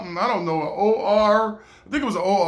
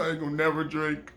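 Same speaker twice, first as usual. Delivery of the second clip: quiet vow; 3 semitones lower, 8 dB quieter, faster than usual. Retold or imitated. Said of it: "I said".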